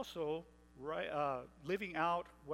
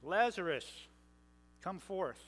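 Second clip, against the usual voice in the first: loud call; 2 semitones higher, slower than usual. A narrator's reading. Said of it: "cried out with a loud voice".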